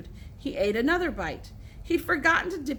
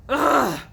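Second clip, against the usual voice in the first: sudden loud "ugh" groan; 3 semitones higher, 9 dB louder than usual. A narrator's reading. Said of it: "lay down on his back and said".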